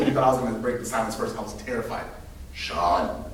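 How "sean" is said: low, quiet, stern name; ominous principal. Retold or imitated. Principal breaking the silence toward the scared kid, low and quiet. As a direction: say his name like a slow threat.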